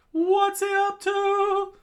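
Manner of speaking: very high, loud, drawn-out question; alarmed yell. Acted. Sanji asking as the flag is tied, high yell. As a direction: shriek it in bafflement. Onlooker baffled by Luffy.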